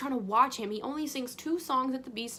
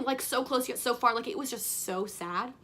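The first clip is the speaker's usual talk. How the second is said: ordinary delivery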